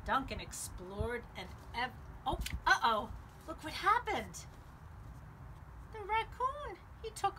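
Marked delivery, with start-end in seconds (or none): none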